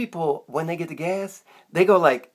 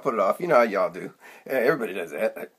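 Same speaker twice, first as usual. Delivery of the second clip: ordinary talk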